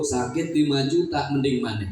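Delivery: ordinary talk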